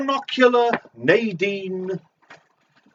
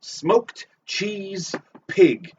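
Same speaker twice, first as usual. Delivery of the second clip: very slow echo; 3 semitones higher, much slower than usual. Acted. Echoing the bingo call, dragging every word slowly. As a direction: drag each word out slowly.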